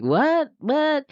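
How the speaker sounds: high, baffled double what; confused what. Retold or imitated. Luke baffled by Yoda, high confused what. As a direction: squeak out the confusion in disbelief. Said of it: "Luke was like".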